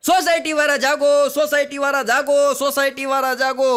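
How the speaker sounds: loud wake-up call to the society; repeated rousing yell at night. Retold or imitated. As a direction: shout it up high to wake everyone. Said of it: "secretary રાડો રાડ થયા".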